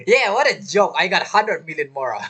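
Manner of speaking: ordinary talk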